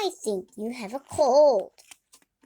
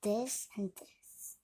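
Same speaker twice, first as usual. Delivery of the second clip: whisper; 3 semitones lower, 11 dB quieter than usual. Real, not acted. Whispered intro to listener.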